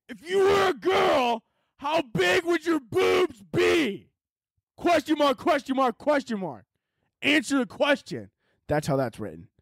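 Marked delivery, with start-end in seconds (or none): 0.1-4.1 high, slow, shouted question; all caps shout. Shown as a demonstration. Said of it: "let me read it actually, how it's written here". 4.7-8.3 high, slow, shouted reading; all caps shouting. Shown as a demonstration. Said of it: "let me read it actually, how it's written here".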